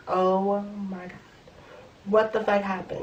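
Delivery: soft dazed morning groan; groggy hungover mumble. Retold or imitated. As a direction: mumble it like you just woke up hungover. Waking up hungover, seeing the mess.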